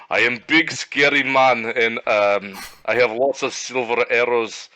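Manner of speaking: put-on thick Russian accent. Player's character bolstering himself among skittering shadows, loud Russian accent. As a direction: put on a hearty Russian accent and pump yourself up. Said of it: "start talking really loudly in a thick Russian accent".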